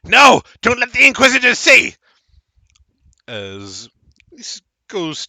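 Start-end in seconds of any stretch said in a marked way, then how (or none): 0.0-1.9 high, loud panicked yell; halfling shrieking after the gumball; frantic shout. Acted. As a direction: scream it while chasing. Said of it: "shouting all the while".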